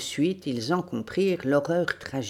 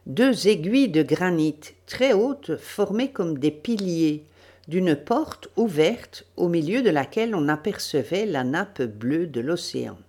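usual speaking voice

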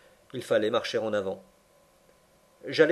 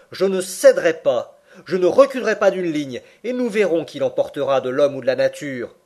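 loud, raised-voice vow of defiance; defiant; 4 semitones higher, 7 dB louder than usual. A narrator's reading. Uncle vowing never to yield to nature, defiant. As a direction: throw down a challenge to the gods. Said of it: "semblait défier les dieux".

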